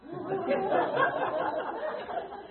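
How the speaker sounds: sudden high laugh; high burst of laughter. Real, not acted. Laugh after her question. (忍不住高声大笑)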